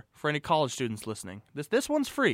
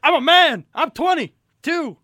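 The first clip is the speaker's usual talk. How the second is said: high, loud shouting coach rant; Mike Gundy rant; 13 semitones higher, 12 dB louder, slower than usual. Retold or imitated. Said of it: "Oh, Mike Gundy".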